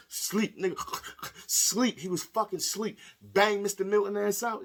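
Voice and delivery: loud, high, excited shout; hyped shouting. Real, not acted. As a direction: yell it like you just saw a knockout. Hyped reaction to the knockout.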